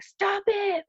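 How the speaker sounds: put-on high little-kid cry of stop it; toddler yelling. Little son shouting at his father, high child voice. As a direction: voice a two-year-old trying to protect mom.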